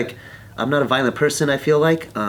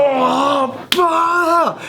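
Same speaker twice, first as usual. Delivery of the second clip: high mock cry of disgust; disgusted outburst; 4 dB louder than usual. Man just spat on in the face, disgusted cry. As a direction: act out recoiling from spit.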